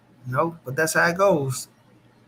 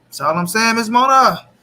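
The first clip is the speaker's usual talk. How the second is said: calling out; 5 semitones higher, 9 dB louder than usual. Real, not acted. Calling out to chatter Miss Mona.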